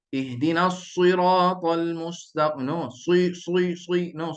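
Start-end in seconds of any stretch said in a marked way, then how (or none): 0.1-2.7 "siratal" wrongly rounded towards "suiratal"; drawn-out "Ihdinas siratal mustaqim" with over-pursed lips; slow mock misreading, pushing the lips out on "siratal"; faulty rounded-lip recitation. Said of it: "Jangan ada suara orang sebut". 2.9-4.1 "sui" with a w-glide after the s; faulty lip rounding. Shown as a demonstration. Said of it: "Jangan ada suara orang sebut".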